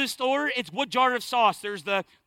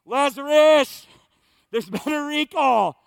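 loud, drawn-out announcer call; imitated heavenly PA page; PA announcement; 4 semitones higher, 6 dB louder, slower than usual. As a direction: call it out over a public address system. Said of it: "on the P A system".

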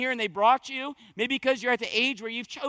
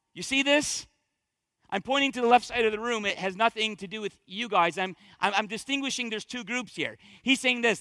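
usual speaking voice

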